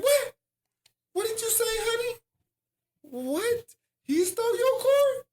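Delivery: very high mock-mom voice; shocked mother. Mother hears her son's card was stolen, high-pitched disbelief.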